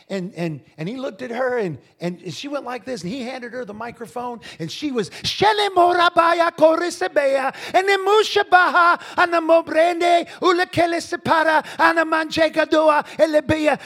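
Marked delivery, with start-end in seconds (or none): 5.1-13.8 high, loud ecstatic tongues; speaking in tongues. Retold or imitated. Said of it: "she went to talking in tongues".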